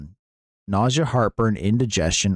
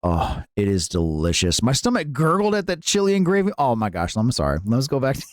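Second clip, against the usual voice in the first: ordinary talk, nothing marked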